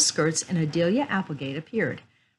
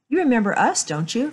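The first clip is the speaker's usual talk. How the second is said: politely, lifting the opening higher and louder; higher-pitched polite opener; polite request; 6 semitones higher, 5 dB louder than usual. A narrator's reading. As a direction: ask with courteous eagerness. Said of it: "Frank asked politely".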